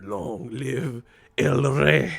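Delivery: slow, grand Spanish-dub line; dramatic Spanish dub. Retold or imitated. Quoting the Spanish Lion King dub, slow and grand. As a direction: draw it out like a solemn movie king. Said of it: "motherfucker said".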